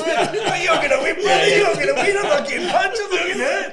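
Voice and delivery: loud, high laughing mock hype; mocking cornermen. Boxer mocking his cornermen's hollow encouragement. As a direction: parody the cheerleading corner, laughing.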